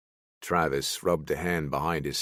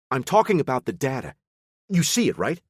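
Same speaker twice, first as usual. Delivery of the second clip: chipper, animated; 7 semitones higher, 5 dB louder than usual. Acted. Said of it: "chipper and animated".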